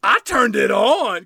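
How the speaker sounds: loud, slow Southern drawl; Daisy. Retold or imitated. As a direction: belt it out in a mock Southern voice. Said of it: "And Daisy says".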